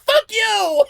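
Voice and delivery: high shouted curse, laughing; mock outrage. Real, not acted. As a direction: yell at the fan, cracking up. Ranting at imagined fan.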